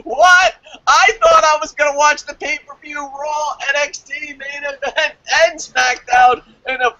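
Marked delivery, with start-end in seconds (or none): none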